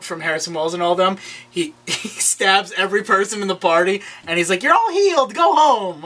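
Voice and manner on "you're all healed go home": high, hammed-up proclamation; mock triumphant healer. Hero has given guests the antidote, mock healer's proclamation. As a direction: announce a miracle cure to the room.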